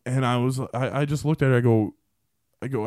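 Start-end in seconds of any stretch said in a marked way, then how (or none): none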